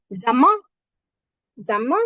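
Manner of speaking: "জামা" with m instead of n, ending on a rise; m pronunciation. Shown as a demonstration. Teacher shows n is said as m, pronunciation demo. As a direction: hold the m in "জামা" and lift the end. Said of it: "এখানে এম এর উচ্চারণ হবে".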